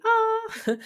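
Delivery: a sudden high "ah!" of fake revelation; mock eureka gasp. Joking about clarity suddenly arriving, high mock eureka "ah". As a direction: squeak out a pretend lightbulb moment.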